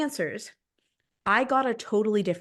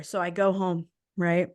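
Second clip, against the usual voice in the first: ordinary talk, nothing marked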